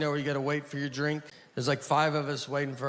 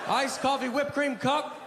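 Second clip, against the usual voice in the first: defiant shout; 8 semitones higher than usual. Retold or imitated. Mock defiant order at Starbucks.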